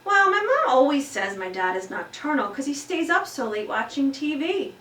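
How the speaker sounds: put-on boy squirming in his seat explains. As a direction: explain it a bit sheepishly. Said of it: "A J squirmed in his seat".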